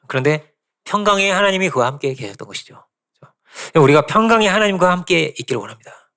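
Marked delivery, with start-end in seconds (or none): none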